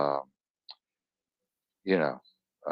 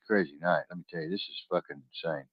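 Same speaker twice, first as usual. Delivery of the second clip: usual speaking voice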